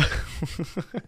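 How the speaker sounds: soft wheezing chuckle; real laugh with little voice; breathless laugh. Real, not acted. Streamer amused by his fake announcement, wheezing.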